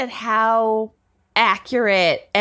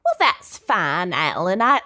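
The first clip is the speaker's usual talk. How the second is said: Texan church lady. Acted. Candy reassuring Alan about her husband, Texan church lady accent. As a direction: draw it out in a Texas twang. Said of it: "Texan church lady murderer accent".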